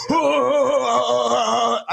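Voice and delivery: high put-on ah ah ah; mock Greg grunts. Teller imitating Greg jumping off the table, put-on ahs. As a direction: bark out short ahs in a different voice. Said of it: "jump down from the table and go".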